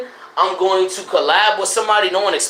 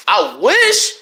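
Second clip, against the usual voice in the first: voice cracking; much slower than usual. Real, not acted. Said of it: "I don't care if my voice cracks".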